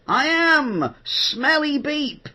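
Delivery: high, slow, cartoon character voice; Smelly Beep voice. Shown as a demonstration. Voice actor showing off his cartoon character, high and slow. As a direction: stretch it out high like a cartoon creature. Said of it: "being the voice of an animation character".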